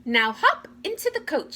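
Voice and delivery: high fairy voice; fairy godmother. A narrator's reading. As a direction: urge her on, high. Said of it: "said the fairy godmother".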